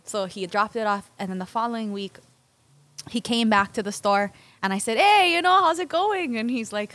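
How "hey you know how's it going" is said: high, loud cheerful "Hey"; bright greeting. Retold or imitated. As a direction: greet him with delight. Greeting returning kid.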